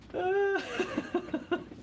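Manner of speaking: sudden high-pitched giggle. Real, not acted. Streamer cracking up at chat joke, high giggle. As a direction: burst into a squeaky laugh. Laughing at own porn joke.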